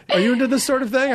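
quick put-on questioning voice; curious questioner. Acts out people questioning him.

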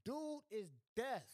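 high, loud, slow emphasis; loud reveal. Acted. Comedian loudly repeating the robber was deaf. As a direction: hammer the point slowly.

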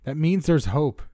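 loud, high urgent shout. A narrator's reading. Narrator encouraging his friend in the winds, shouting. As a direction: shout hope across the storm.

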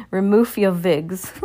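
witch's snarl. Acted. Grand High Witch snarling at witches, slow and harsh. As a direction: snarl it with contempt. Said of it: "snarled the High Grand Witch".

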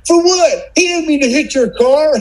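very high, whiny imitation of his wife; husband mocking his ex-wife's plea for the deer, very high and whiny. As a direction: parody her naive protest in a squeaky voice. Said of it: "And she was like".

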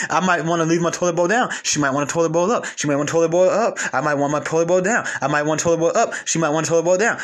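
usual speaking voice

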